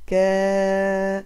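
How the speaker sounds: كا held long, with a stretched open a. Shown as a demonstration. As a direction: draw out كا so the long vowel is clear. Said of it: "مع مد الألف كان صوتها".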